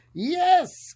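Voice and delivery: high, loud eager yes; cried yes. Acted. Witch welcoming the dog aboard, high bright cry. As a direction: call out a happy yes to the dog. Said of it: "cried the witch".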